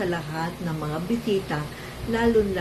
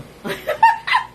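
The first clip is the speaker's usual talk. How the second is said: giggle; 9 dB louder than usual. Real, not acted. Laughs showing missing teeth.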